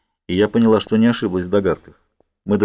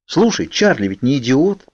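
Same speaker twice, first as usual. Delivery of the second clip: higher, drawn-out protest; indignant; 5 semitones higher than usual. A narrator's reading. Girl objecting to Edward's plan, indignant. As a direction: protest with slow, raised-pitch indignation. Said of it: "возмутилась я".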